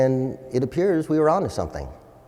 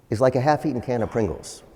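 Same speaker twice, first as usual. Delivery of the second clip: ordinary talk, nothing marked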